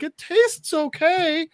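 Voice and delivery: high, slow imitated captive; reluctant approval. Captive eating hot dogs for maniac, high slow approval. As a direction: draw it out high, placating. Voices captive forced to taste.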